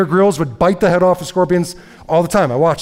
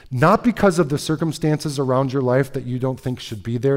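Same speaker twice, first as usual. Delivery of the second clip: ordinary talk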